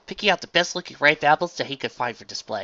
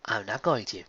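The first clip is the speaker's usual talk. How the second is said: usual speaking voice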